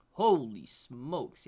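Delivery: slow, drawn-out amazement; amazed. Real, not acted. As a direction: stretch it out in disbelief.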